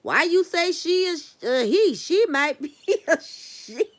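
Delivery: put-on loud, high, scolding quote of the girl. Quoting a movie girl to her viewers, loud and high. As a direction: belt it out like the girl in the movie, breaking into a laugh.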